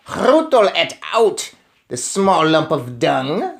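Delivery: slow, loud witch screech. Acted. Witch queen hunting the boy, screeching. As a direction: screech it with venom. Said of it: "screeched the Grand High Witch".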